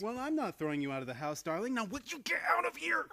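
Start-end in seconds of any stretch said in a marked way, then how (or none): 1.7-3.1 exasperated shoo. Acted. Pushing her out.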